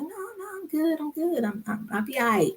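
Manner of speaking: soft, high retold reassurance; imitating a dismissive husband; brushing off concern. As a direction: wave it away lightly, voice up and small. Husband waves off her worry.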